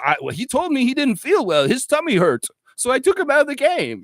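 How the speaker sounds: lightly mocking Darko. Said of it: "Darko said in the interview".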